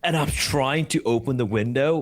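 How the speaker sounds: ordinary delivery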